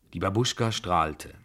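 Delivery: usual speaking voice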